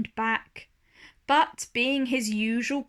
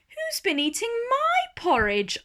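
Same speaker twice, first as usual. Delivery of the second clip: much higher middle bear voice; medium bear voice; 12 semitones higher than usual. A narrator's reading. Medium bear asks about her porridge, high voiced. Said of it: "asked the medium voice".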